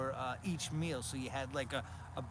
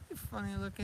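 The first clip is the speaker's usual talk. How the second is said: high playful coo to a dog; teasing pet talk; 8 semitones higher than usual. Real, not acted. Owner teasing the dog chewing grass, high pet voice. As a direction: tease the pup in a raised pitch.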